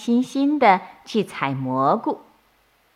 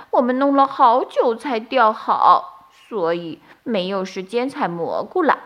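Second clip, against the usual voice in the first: apologetic bunny; 6 semitones higher than usual. A narrator's reading. Bunnies apologise to mother.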